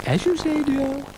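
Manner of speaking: very high, fluting ladylike voice; dotty lady cow. Acted. Ermintrude doubts Brian is himself, high fluting voice. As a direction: ask it in a high, dotty flute.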